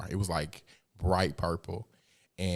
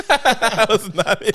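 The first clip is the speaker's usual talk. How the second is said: laughing; 13 semitones higher, 9 dB louder, slower than usual. Real, not acted. Laughing mid-story.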